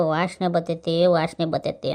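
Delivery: mock complaining wife. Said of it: "ಅಂತಾರೆ".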